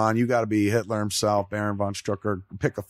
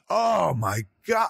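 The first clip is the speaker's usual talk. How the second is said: overcome outburst; 7 semitones higher, slower than usual. Real, not acted. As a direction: let the emotion burst out. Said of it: "I'm bawling in the theater".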